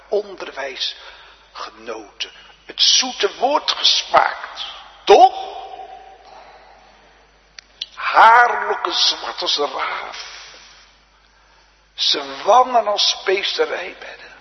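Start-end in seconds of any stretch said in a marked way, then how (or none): 2.7-14.3 loud, high, drawn-out pulpit delivery; fervent preaching. Real, not acted. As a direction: proclaim it slowly and loudly to the congregation. (gedragen, luide preekstem)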